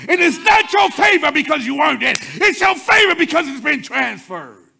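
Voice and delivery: full-force preaching. Acted. Preacher lifting the church to a shout. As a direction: build the congregation up, hammering each phrase.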